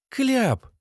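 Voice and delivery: high, short female exclamation; woman reminding. Acted. Lena reminds Sasha of the gag, high-voiced prompt. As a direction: pitch the reminder way up.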